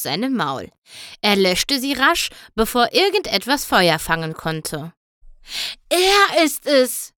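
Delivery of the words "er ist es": high, drawn-out excited call; urgent, high-pitched exclamation; excited cry. A narrator's reading. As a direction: call it out in alarm at a discovery. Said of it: "rief er dann".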